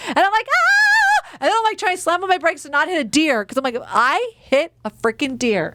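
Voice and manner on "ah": very high startled shriek; startled scream. Retold or imitated. Deer stepping onto the path, high shriek.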